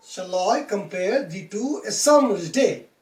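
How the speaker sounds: "Shall I compare thee to a summer's day?" with I, PARE, TO, SUM, DAY hit harder; stress every second syllable. Shown as a demonstration. Teacher repeating the rhythm for students, stressing every second syllable of "Shall I compare thee to a summer's day?". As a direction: swing "Shall I compare thee to a summer's day?" weak-strong, five beats. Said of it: "read this line like this".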